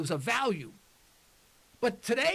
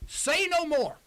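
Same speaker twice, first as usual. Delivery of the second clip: retold angry yell; 6 semitones higher, 3 dB louder, slower than usual. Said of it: "Oh, he yelled at her".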